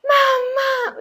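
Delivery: put-on very high, sudden child's cry; child calling out. Retelling her son coming back to himself, calling out. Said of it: "fue así como".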